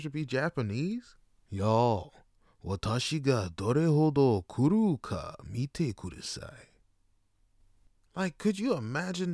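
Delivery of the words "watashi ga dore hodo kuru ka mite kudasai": ordinary delivery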